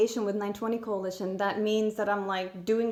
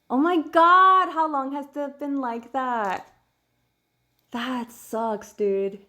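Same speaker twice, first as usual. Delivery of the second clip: commiserating; 2 semitones higher, 6 dB louder, slower than usual. Real, not acted. Reacts to something on screen.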